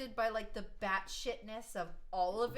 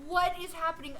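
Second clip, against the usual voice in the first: louder, higher bewildered cry; mock alarmed; 5 semitones higher, 7 dB louder than usual. Reacting to the Irish act, alarmed. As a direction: blurt it out, bewildered. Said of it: "I was just like".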